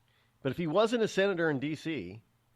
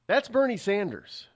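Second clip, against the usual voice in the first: usual speaking voice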